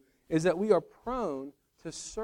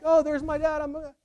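happy daughter; 12 semitones higher, 6 dB louder than usual. Retold or imitated. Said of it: "she's not like".

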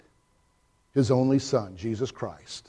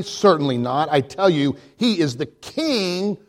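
higher and louder, building to a ringing climax; stern, rising proclamation; 7 semitones higher, 5 dB louder than usual. A narrator's reading. Mister Beaver sternly telling Lucy who Aslan is, building to a loud climax. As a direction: declare it like a proclamation. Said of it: "said sternly".

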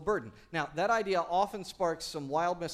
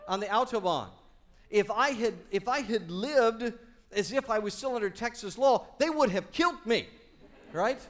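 excited; 7 semitones higher, 7 dB louder than usual. Real, not acted. Excited Autobahn story.

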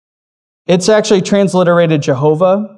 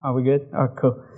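ordinary talk

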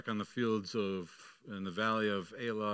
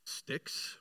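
breathy, softer scoffing "Sticks?"; scornful whisper; 7 dB quieter than usual. Retold or imitated. Goliath scoffing at David's staff, whispered contempt. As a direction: spit out "Sticks?" in disbelief. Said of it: "with scorn and derision".

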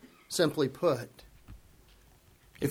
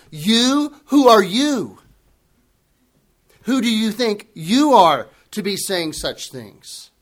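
"You?" punched higher and louder, "Who are you?" jabbing; emphasis on you; 3 semitones higher, 4 dB louder than usual. Retold or imitated. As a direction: jab at Jesus with the word "you". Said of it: "the "you" is placed in the emphatic position".

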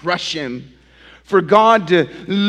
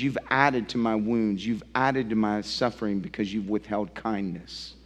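quiet reproach; 6 semitones lower, 7 dB quieter than usual. Acted. Job accusing friends softly.

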